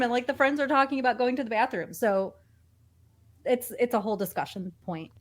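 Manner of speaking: ordinary delivery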